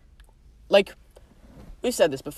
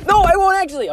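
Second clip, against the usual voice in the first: high, loud burst of denial; sudden exclamation; 11 semitones higher, 5 dB louder than usual. Real, not acted. Taking back a joke threat to the co-host, sudden exclamation. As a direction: blurt it out, pitch leaping up. Joking retraction of exaggerated threat.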